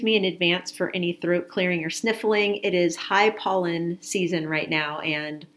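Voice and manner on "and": usual speaking voice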